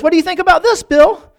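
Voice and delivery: high, loud acted-out fury; hammed-up angry biker; incensed taunt. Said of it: "he was incensed".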